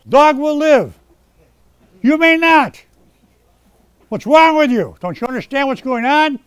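angry preacher losing patience; angry scolding. Real, not acted. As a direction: scold the congregation for not listening. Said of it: "forgive me for being angry".

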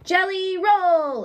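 high, slow cry of surprise; drawn-out astonished call; surprised exclamation. A narrator's reading. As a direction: gasp it out in amazement. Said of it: "exclaimed Amelia Bedelia".

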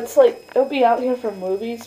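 ordinary talk

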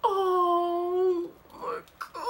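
high, half-laughing cry. Real, not acted. Squealing through pain while peeling the mask.

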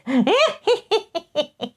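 a burst of high laughter. Real, not acted. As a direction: laugh high at your own joke. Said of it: "Oh, that's just mean".

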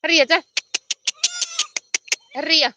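loud, high short shouts; herding call. Real, not acted. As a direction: call the animals in from a distance. Calling out to herded goats.

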